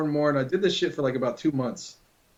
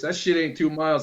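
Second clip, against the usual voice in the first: ordinary delivery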